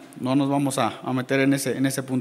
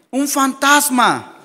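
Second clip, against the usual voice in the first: higher, slow drawn-out cry of fear; fearful cry; 7 semitones higher, slower than usual. Acted. Disciples in the dark sea seeing Jesus walk, slow fearful cry. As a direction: stretch it out as a spooked wail. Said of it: "dieron voces, dice, de miedo".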